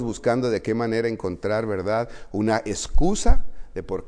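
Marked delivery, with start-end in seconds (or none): none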